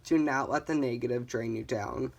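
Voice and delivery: ordinary talk, nothing marked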